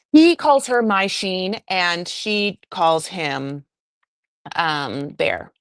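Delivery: ordinary talk, nothing marked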